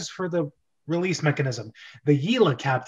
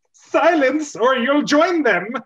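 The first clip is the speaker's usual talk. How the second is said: much higher, louder order; stern command; 9 semitones higher, 7 dB louder than usual. Acted. Captain silencing Toad with a loud command. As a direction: bark the threat.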